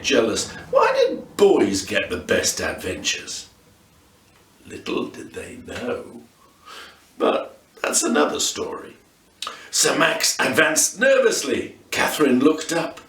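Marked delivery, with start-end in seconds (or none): 4.7-9.0 whispered, teasing storyteller aside; hushed aside. Acted. Narrator whispering a teaser to the listeners, hushed. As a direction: drop to a secretive whisper.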